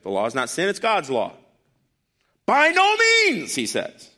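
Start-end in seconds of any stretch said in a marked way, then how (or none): 2.5-3.5 high, loud, drawn-out outburst; rowdy exclamation. Acted. Preacher voicing Paul's answer, a rowdy drawn-out shout. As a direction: belt it out high, stretching each word. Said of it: "'cause he's rowdy".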